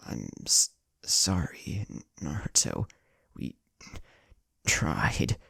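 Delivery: faltering, hurt voice breaking; weak and coughing. A narrator's reading. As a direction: gasp out the apology through pain. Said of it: "Tsunade coughed".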